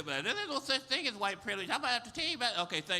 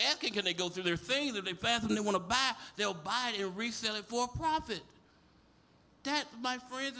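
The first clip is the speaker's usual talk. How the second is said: ordinary talk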